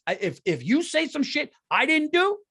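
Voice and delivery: can't hold it in; heated outburst; worked up. Real, not acted. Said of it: "I can't even fucking stop".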